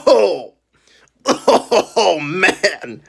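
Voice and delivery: laughing through a sour bite; chuckling, can't hold it in. Real, not acted. Laughing while tasting sour candy.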